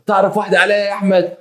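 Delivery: high, accusing mock question; betrayed wife's reproach. First wife accuses husband of seeing another, high indignant reproach.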